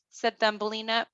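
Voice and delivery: ordinary delivery, nothing marked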